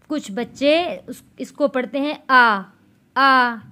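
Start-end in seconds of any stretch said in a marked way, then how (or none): 2.3-3.6 hamza and ain both said as a plain "आ"; imitating kids who merge the two sounds; the children's mistake. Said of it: "यहाँ पर गलती क्या कर देते हैं". (बच्चों की गलती की नकल, दोनों "आ" एक जैसे)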